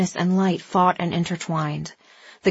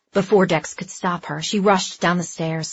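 usual speaking voice

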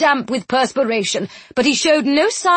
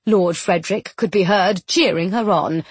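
ordinary delivery, nothing marked

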